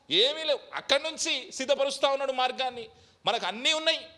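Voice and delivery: loud, high fiery sermon delivery; preaching at full force. Acted. Pastor exhorting the congregation, loud and high fiery preaching. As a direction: thunder it out from the pulpit.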